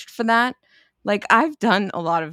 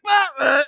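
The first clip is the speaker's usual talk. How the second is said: mock retch; 8 semitones higher than usual. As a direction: bark out a retching noise. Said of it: "she's like".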